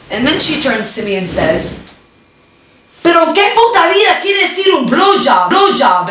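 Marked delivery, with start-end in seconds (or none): 3.0-6.1 high screamed accusation in Spanish; furious shout. Retold or imitated. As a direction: scream it at the daughter.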